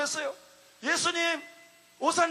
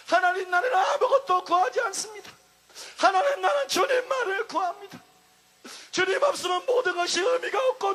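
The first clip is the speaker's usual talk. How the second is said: crying prayer; 3 semitones higher than usual. Retold or imitated. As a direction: pour it out, half-shouting and sobbing. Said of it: "계속해서 탄식했습니다".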